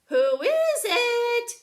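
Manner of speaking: wolf faking a high, slow sweet granny; sweetest Granny voice. Acted. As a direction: coo it like a sweet old granny. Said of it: "in his sweetest Granny voice".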